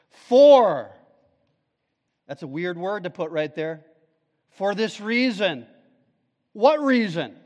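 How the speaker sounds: high, loud fervent sermon; preaching at full force. Acted. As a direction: drive the verse home to the church. Preacher rises again.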